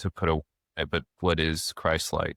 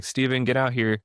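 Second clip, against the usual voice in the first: higher mock urgent call across the house; wife calling her husband, imitated urgent call; calling out for help; 6 semitones higher than usual. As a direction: imitate the wife shouting a name from another room.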